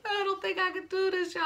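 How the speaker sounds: high, soft, tearful whimper; a scared patient about to cry; near tears. Retold or imitated. Before surgery, telling her family, tearful and high.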